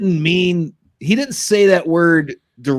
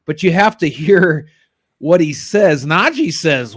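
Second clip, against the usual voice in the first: normal talking voice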